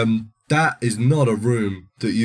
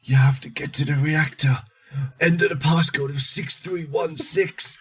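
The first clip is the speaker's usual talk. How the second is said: high, urgent voice rattling off steps; dying man's instructions; 9 semitones higher than usual. Retold or imitated. Dying man telling the hero how to stop the reactor, high and urgent. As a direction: gasp out the orders before you die. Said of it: "Or it goes".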